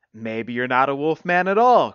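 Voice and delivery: loud, teasing suggestion; hint at it with a wink in the voice; sly suggestion. A narrator's reading. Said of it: "suggested with a wink".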